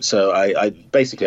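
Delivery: ordinary talk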